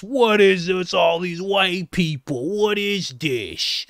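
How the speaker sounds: slow, dragged mock bewilderment; mocking upset reporter. Vlogger mimicking the reporter's outrage again, slow mock bewilderment. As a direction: play her flustered disbelief. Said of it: "She was so upset".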